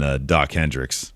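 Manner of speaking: ordinary talk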